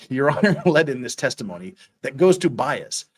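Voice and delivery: ordinary talk, nothing marked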